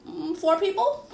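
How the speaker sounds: sheepish guess. Retold or imitated. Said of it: "I said".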